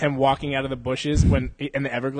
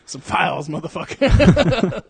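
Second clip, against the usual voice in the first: drawn-out, louder last word through laughter; laughing catchphrase riff; 5 semitones higher, 8 dB louder, slower than usual. Acted. Host topping his own running joke, laughing through it. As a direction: crack up while doing the one-liner.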